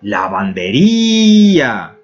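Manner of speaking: lavanderia spoken very slowly, each syllable drawn out; drawn-out example word. Shown as a demonstration. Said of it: "a palavra".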